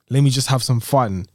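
normal talking voice

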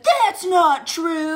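slow, drawn-out snooty voice; snooty correction. Pretend. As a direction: drag out a smug correction. Mock internet troll reply.

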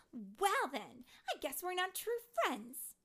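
high, very loud, huffy retort; Tink's angry voice. A narrator's reading. Tink storms off from Terrance, angry. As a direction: fire back high and loud. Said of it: "I'm back to Tink".

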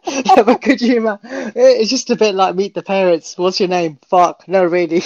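high, loud, laughing through words; laughing while talking. Real, not acted. Co-host laughing through his Meet the Parents joke. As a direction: talk through giggles.

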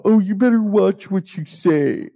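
high, put-on scolding voice; exaggerated singsong caution; mocking warning. Mocks imagined warning from relatives.

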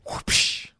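voiceless "whoopsh" mouth noise; whooshing sound effect. Shown as a demonstration. Showing co-host the quick draw, imitated whoosh.

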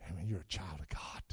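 a hushed, intimate whisper. Real, not acted. Preacher whispering to a returned brother, hushed. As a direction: breathe it to them like a secret.

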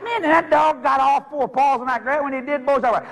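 ordinary talk, nothing marked

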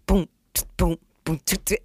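beatbox the beat lightly. Retold or imitated. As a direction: mouth drum boom and tsk. Beatboxing his drum beat.

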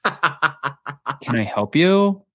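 very slow, drawn-out icy question; slow mock-withering greeting; intimidating look. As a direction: imitate someone coolly sizing up a stranger. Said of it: "she looks at you, like, in person".

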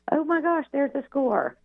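high, gleeful surprise at the envelope; excited exclaim. Retold or imitated. College friends reading the predicted score, high and delighted. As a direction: squeal it like the guess came true.

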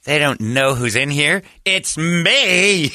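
high, drawn-out self-important boast; Jon Lovitz voice. Retold or imitated. Lovitz answers the honking cars, grand showy impression. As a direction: stretch it out, grandly pleased with your own fame. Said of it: "In that voice".

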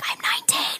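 hushed whisper, no voice; whispered confession. Retold or imitated. Underage kid admitting age in a bar, whispered. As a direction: whisper it like a secret.

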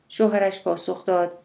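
ordinary delivery, nothing marked

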